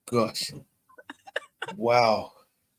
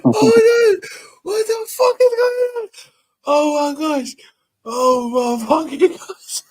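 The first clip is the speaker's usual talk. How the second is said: high squealing laugh-cries, losing control; laughing so hard the tears come; crying with laughter; 14 semitones higher, 7 dB louder than usual. Real, not acted. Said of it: "My eyes is running".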